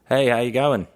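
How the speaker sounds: Australian accent, lifted and chirpy; Aussie voice. Shown as a demonstration. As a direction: greet someone in a put-on Aussie accent. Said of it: "I'll do an Aussie voice".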